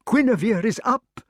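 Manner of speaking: high joyful exclamation; excited king. Acted. Arthur exclaiming.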